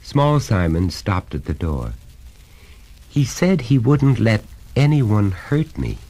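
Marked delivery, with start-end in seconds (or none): 3.1-6.0 higher, mildly plaintive; understated whimper; whimpering child. A narrator's reading. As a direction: plead like a small hurt boy. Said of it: "he whimpered".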